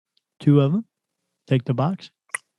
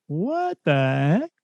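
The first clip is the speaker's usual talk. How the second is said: startled; 2 semitones higher than usual. Retold or imitated. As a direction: jolt up in alarm. Group startled by mysterious noise.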